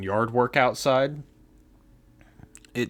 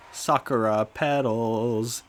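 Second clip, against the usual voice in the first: normal talking voice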